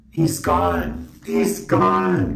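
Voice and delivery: dramatic wailing sob voice; mock wail, pitch jumping high. Friend wails over [name].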